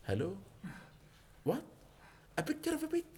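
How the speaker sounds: high, soft mock astonishment; mock disbelief. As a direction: feign shock, voice shooting up.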